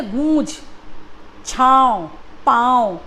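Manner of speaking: गूंज, छाँव, पाँव with vowels through nose and throat; one word at a time, गूंज, छाँव, पाँव nasalised. Shown as a demonstration. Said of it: "गले और नाक पे इसका असर पड़ रहा है".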